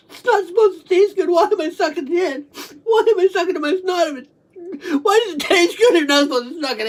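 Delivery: high-pitched whining cry with laughs; tearful bewilderment. Real, not acted. Speaker baffled by the taste of snot, tearful bewilderment. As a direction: wail the question through tears and giggles.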